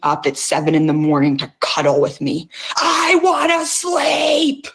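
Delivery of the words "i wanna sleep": drawn-out, higher yell of a woman who wants to sleep; annoyed yell. Retold or imitated. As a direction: yell it slowly, fed up and half-asleep. Annoyed at husband waking her.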